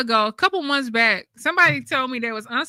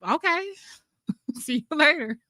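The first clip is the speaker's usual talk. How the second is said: higher, breezy okay, laughing into see you later; dismissive goodbye; 5 semitones higher than usual. Retold or imitated. Brushing off the angry commenter, laughing goodbye. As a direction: wave her off with a laugh. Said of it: "because I was like".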